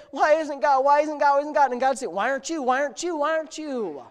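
high, loud sermon build; preacher rousing the congregation; full-force preaching. Acted. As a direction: preach it up to the crowd.

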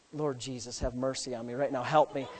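low, soft plea to heaven; hushed mock prayer. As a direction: pray under your breath in playful desperation. Acted prayer on seeing huge buck.

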